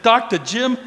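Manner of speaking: loud, high Boston greeting; loud Boston accent. Acted. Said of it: "a loud voice saying in a Boston accent".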